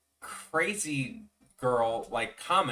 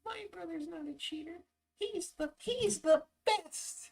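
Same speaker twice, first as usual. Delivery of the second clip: very high squeaky voice, softer and slow at the end; squeaky little brother; 4 dB quieter than usual. Retold or imitated. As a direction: ham up the devoted kid brother. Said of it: "I even did the little arm swing".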